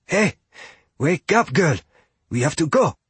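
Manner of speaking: nudging a friend awake, worried; urgent gentle coaxing. Acted. Fia rouses unconscious friend.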